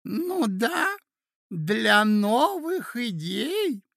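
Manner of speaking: put-on high, slow old man insisting; deeply convinced prince. A narrator's reading. Old prince repeating himself to puzzled ladies, deeply convinced. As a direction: draw it out with total conviction. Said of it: "с видом глубочайшего убеждения".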